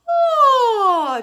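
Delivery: loud high yawning oh. Shown as a demonstration. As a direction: open up like a yawn. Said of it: "it's almost like a yawn".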